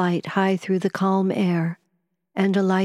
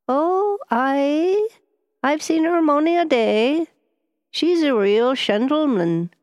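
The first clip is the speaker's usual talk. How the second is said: higher, slow Highland Scots lilt; slow Highland dialect; 9 semitones higher, 3 dB louder, slower than usual. A narrator's reading. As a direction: correct them in an unhurried Highland accent. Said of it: "soft, slow, almost unintelligible Highland dialect".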